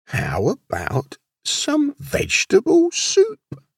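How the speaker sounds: slow, climbing high in pitch; coaxing suggestion. A narrator's reading. Wolf offering the lamb supper, slow and coaxing. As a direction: draw it out and lift it high.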